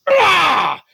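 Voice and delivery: high loud chomping yell; monster bite roar. Acted. Shark's teeth regrow and it bites Cyrus, loud roar. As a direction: lunge in with a sudden roar.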